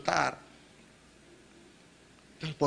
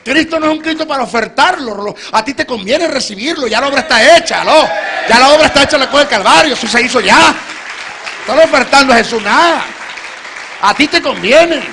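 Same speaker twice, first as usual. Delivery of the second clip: high, loud impassioned preaching cry; preaching at full force; 10 semitones higher, 14 dB louder than usual. Acted. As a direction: shout the truth home to the crowd. Predicación a pleno volumen. (predicación a gritos, apasionada)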